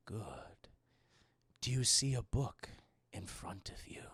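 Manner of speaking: very soft, low whisper; sinister other voice. Retold or imitated. An unseen voice shows Catherine a book, in an eerie whisper. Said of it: "we hear this other voice in the room".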